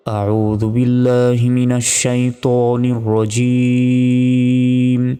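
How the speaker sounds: slow, drawn-out melodic chant; Quran recitation. Shown as a demonstration. Teacher reciting Sura Yasin for the class, slow sung tilawat. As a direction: intone each word long and sung like tilawat.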